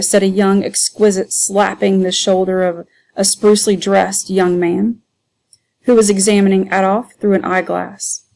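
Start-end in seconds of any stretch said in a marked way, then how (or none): none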